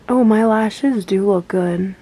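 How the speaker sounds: usual speaking voice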